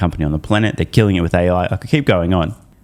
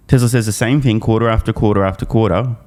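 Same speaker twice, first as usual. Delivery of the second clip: normal talking voice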